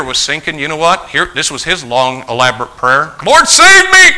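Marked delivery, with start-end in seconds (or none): 3.2-4.1 high, loud sudden yell; desperate cry for help. Acted. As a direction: cry out as if going under. Acts out Peter sinking.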